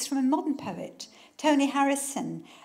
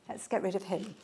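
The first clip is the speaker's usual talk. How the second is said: ordinary talk, nothing marked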